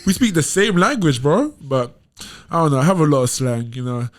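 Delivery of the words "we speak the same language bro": higher, insistent; retold exasperated reply. To curious Americans, higher and insistent. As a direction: insist it's the same language, higher. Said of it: "I'm like".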